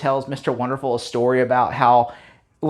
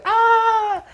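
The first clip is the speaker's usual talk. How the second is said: very high squeal, the top of the build; hammed-up peak of shock; squealing shriek. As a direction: go all the way up into a shriek. Third escalating recognition yelp.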